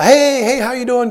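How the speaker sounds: high-pitched mock hello to arriving guests; effusive greeting.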